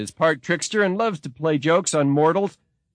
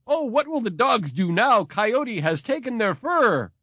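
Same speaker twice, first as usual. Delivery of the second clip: mock loud cry; 7 semitones higher than usual. Coyote taunts the dogs from outside the sweat lodge, mock loud cry. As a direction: call it out higher, slowing down, without real volume. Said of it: "said in a loud voice".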